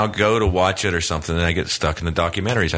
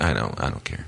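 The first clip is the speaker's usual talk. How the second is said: indifferent; 10 semitones lower, 8 dB quieter than usual. Retold or imitated. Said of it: "I'm like".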